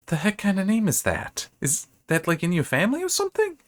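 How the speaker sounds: high, incredulous squeak of a question; skeptical mouse voice. Retold or imitated. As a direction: play the mouse, baffled by the name.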